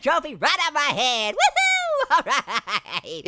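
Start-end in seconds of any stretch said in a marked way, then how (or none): 1.3-2.1 very high celebratory whoop; victory whoop. Real, not acted. Player celebrating the trophy, whooping.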